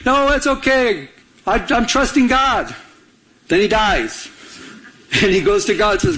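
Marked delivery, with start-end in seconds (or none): 0.1-2.7 high, slow, unbothered brush-off; blithe refusal. Acted. Man on the roof waves off the helicopter, blithe refusal. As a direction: act out serenely declining rescue.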